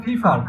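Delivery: ফিফার with puffed "ph" for p; British-style pronunciation. Shown as a demonstration. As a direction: say Pipar as ফিফার, aspirating the p. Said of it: "পিপারটা হবে".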